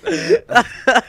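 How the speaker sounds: can barely talk for laughing. Real, not acted. Cracking up at friend's hiccups.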